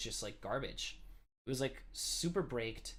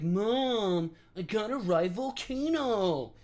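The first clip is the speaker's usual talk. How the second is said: put-on high, loud, slow pleading child voice; whiny kid begging; 9 semitones higher, 8 dB louder, much slower than usual. Kid begging mom to stop at the park, loud whine. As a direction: insist like a stubborn kid. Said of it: "I'd be like".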